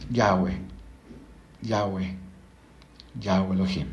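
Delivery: "Yahweh" low and even, neither syllable stressed; unaccented, exhaled. Shown as a demonstration. Teacher leading listeners to breathe out, "Yahweh" low and unaccented. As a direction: breathe out "Yahweh" softly with no accent on either syllable. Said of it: "luego diga la palabra Yahweh sin acento".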